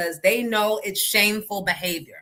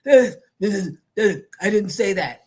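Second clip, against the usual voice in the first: louder, very slow, strained mimicry as if out of breath; mock labored speaker; 5 dB louder, much slower than usual. Host mocks a politician who makes listeners nervous, labored imitation. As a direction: imitate someone struggling for every breath. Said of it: "Every breath is gonna be the last one".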